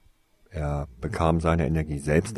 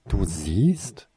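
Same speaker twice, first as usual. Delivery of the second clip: erstaunt; 3 semitones higher, 3 dB louder than usual. A narrator's reading. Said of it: "fragte sie erstaunt".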